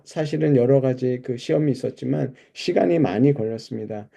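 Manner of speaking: ordinary talk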